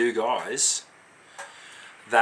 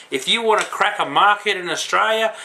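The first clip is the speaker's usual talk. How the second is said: ordinary talk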